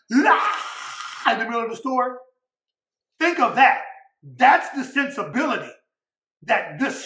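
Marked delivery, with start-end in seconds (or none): 0.1-1.3 breathy mock kid scream, voiceless; imitated toddler shriek. Preacher imitating a kid's tantrum shriek in a store. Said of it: "And the kid's like".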